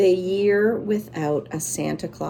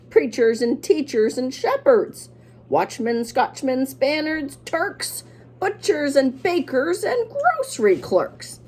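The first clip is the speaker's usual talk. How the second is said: higher and louder, building up the list; excited outcry; 6 semitones higher, 3 dB louder than usual. A narrator's reading. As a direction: cry it out with eyes ablaze. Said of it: "He cried with his eyes ablaze".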